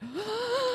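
sharp high mock gasp of fright; retold startled gasp. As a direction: gasp like you just saw her there. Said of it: "and I was like".

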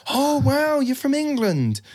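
very high, mock-amazed American voice; gushing American impression. American thrilled to meet a Londoner, gushing American accent. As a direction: gush like an American meeting someone English. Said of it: "Anyone who's from New York's like".

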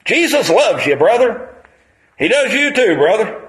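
lightly hammed-up street evangelist; imitating street preacher. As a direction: call it out like the bicycle preacher. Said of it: "He rode by me one day".

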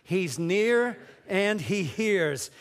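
"He's near and he hears" said very slowly, leaning on "near"; slow call-and-response. Shown as a demonstration. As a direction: draw out "He's near and he hears" for the congregation to repeat. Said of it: "One more time".

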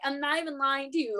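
ordinary delivery

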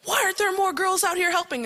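loud, high-pitched scolding shout; imitated yelling. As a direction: yell it like a military man ordering people about. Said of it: "And then he's yelling".

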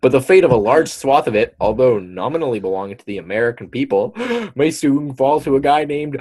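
building suspense toward the name; article read-aloud. Acted. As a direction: read it dramatically, gasping before the reveal.

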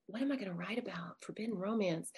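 quietly thinking aloud, half-whispered; hushed puzzling. Retold or imitated. Author asking herself what to write for the forbidden romance collection, hushed puzzling. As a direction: wonder it under your breath. Acts out her puzzled inner thought.

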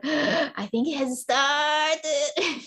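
slow, high, drawn-out news-breaking; excited announcement. Retold or imitated. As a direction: stretch it out with rising excitement. Retold voice message as labor starts.

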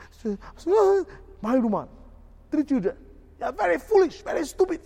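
very high, loud shrieking rant; mock shouting boss. Boss shrieking at his employee, hammed-up yell. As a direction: scream down at the employee. Boss berating worker, worker apologizing.

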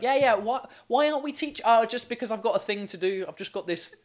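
starts high, then settles into mock excuses; teasing imitation of someone making excuses; mimicked excuse-maker. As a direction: act out a teacher fobbing people off. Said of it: "And you're like".

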